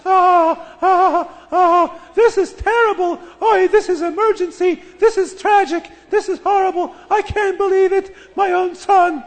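high, loud, laughing-shrieking panic; hysterical mother. Acted. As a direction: wail it out like a mother in a frenzy.

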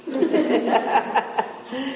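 bursts out laughing, high and delighted; real laugh. Real, not acted. Rabbi laughing at the little girl's answer.

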